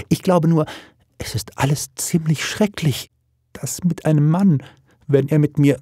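put-on high-pitched, embarrassed girl; flustered young daughter. Blushing daughter on marriage to a man, flustered. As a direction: stumble through it high and awkward.

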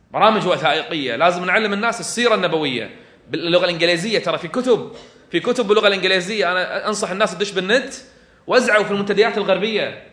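loud, driving sermon call to action; rally the faithful at full force; fiery preaching. Acted.

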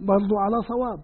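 usual speaking voice

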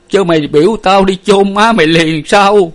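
loud, high grieving protest; choked with grief. A narrator's reading. Bereaved father answers his daughter, choked with grief. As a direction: cry out in anguish, choking up. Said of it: "Ông Năm nghẹn ngào".

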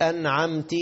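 "أنعمت" ending in ti; common mistake. Retold or imitated. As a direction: say "أنعمت" as an'amti. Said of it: "هنا بيقول لك". («أنعمت» بكسر التاء خطأً)

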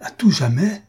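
JAMAIS swinging up into a high question; stupefied repeat. A narrator's reading. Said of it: "frappé de stupeur".

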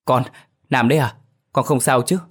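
urgently seek reassurance from the son; worried mother. A narrator's reading. Mother asks son on phone if he's hurt, worried.

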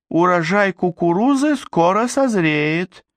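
very high, dreamy polite voice; polite reply. A narrator's reading. Befuddled princess answers a worried friend, politely high. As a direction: answer politely in a high, oblivious sing-song. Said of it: "вежливо отозвалась она".

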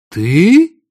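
loud high shocked exclamation; surprised. A narrator's reading. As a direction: gasp out the word in shock. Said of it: "удивились они".

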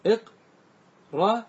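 "إقر" very slowly as iq... ra, long gap between; syllable by syllable. Shown as a demonstration. Teacher dissecting the form, "إقر" in slow, separated syllables. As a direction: hold apart the two parts of "إقر".

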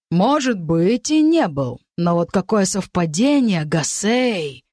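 ordinary delivery, nothing marked

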